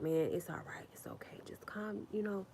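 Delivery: hushed whisper calming her down; whispered soothing. Retold or imitated. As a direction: whisper it gently to settle her. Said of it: "he was like".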